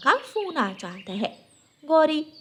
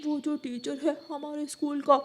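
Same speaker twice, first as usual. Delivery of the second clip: put-on young son; 4 semitones higher than usual. Son timidly explains to father.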